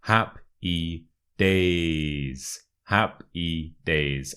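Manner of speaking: "Happy days" broken up, long A in "days"; slow syllable demo. Shown as a demonstration. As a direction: stretch the vowel of "days". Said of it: "a long A sound".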